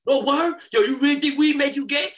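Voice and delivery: excited street voice. Retold or imitated. Voicing guys at weed spot.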